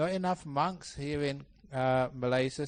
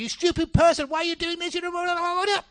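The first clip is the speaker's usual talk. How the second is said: high-pitched mock tirade, running into gabble; loud, high, imitated angry rant; angry outburst; 8 dB louder than usual. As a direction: explode in hammed-up rage. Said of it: "when people start getting angry".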